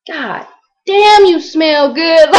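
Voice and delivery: loud, high mock-stunned exclamation; drawn-out, high-pitched amazed compliment; imitating the admirer. Woman retells a stranger's compliment on her perfume, loud amazed imitation. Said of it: "He was like".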